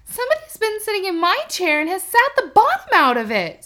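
put-on squeaky baby bear, upset; high wee voice with chair broken; little small wee voice. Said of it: "in his little small wee voice".

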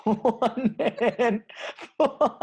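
words stretched slowly through helpless high laughter; can barely get it out for laughing. Real, not acted. Friend cracks up at the one handful joke, laughing.

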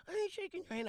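very high-pitched crybaby refusal; mock crying. Crying kid refusing a handshake, imitated in mock high whine. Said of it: "He's crying".

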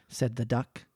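plain reading voice

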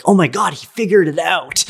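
high, thrilled mock voice; comic, high-pitched excited outburst; excited waiting demons. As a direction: voice a queue of demons amazed at the news. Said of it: "like they're all in line".

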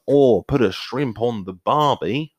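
slow, stretched Australian accent. Shown as a demonstration. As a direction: ham up a broad Australian accent. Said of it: "Australian, I feel".

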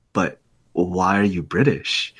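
slow, low retold question, each word drawn out; mock-serious interviewer, slowly pressing the point in a low voice; deadpan insistence. Prank interviewer pressing Tom Holland on being British, slow low deadpan.

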